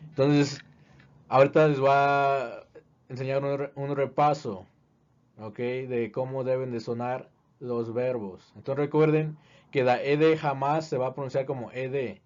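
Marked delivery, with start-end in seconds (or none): none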